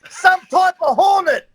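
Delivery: high, loud, laughing through the excuse; retold excuse yelled through laughter; laughing shout. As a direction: shout it while cracking up.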